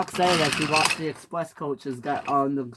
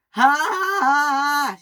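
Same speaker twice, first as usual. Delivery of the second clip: high, loud mocking "ha ha ha"; shouted laugh; 11 semitones higher, 5 dB louder than usual. A narrator's reading. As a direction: laugh loudly at a rival's mishap. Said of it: "shouted Henry".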